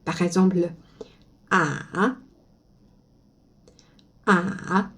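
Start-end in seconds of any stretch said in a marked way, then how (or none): 1.5-4.9 ah dipping down then rising; third tone. Shown as a demonstration. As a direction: let ah fall and come back up. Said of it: "tu descends et puis remontes". (troisième ton, ah descendant puis remontant)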